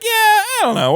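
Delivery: very high-pitched hedging; mock doubtful. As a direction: sound comically unconvinced. Voicing an unconvinced viewer.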